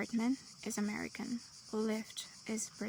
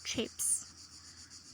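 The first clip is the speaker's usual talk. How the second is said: soft whispered model word; whispered example word; 6 dB quieter than usual. Shown as a demonstration. Teacher whispering a vocabulary word to the students. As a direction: breathe the word out to the class. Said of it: "Chips is British".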